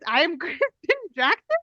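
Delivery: "Jackson?" lifted into a rising question; uptalk. Retold or imitated. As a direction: retell your name as a puzzled question. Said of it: "I read that I was".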